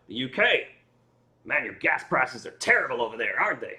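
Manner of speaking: a hint of a chatty Texan immigration officer. Retelling the Newark officer's greeting, a touch of Texan accent. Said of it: "he's like".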